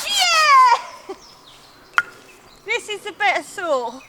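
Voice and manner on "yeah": loud high laugh into a cheer; victory whoop. Real, not acted. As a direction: celebrate the win with a yell. Wins the sawing race.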